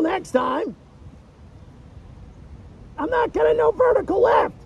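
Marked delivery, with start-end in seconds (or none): 3.0-4.5 high-pitched call for help to mission control; mock distress call. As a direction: call out to Houston in comic alarm, pitched up.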